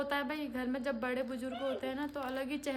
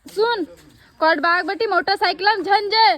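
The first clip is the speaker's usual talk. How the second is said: very loud, raised-pitch shout; calling out; 6 semitones higher, 16 dB louder than usual. Real, not acted. Woman calls out motorcycle travel plans, loud shouting. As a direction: holler it so it carries.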